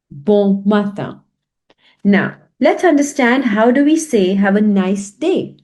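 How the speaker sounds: normal talking voice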